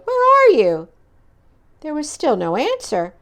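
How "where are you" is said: high loud worried question; anxious loud call; calling anxiously. Acted. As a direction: call out into the dark. Toad searching for frog.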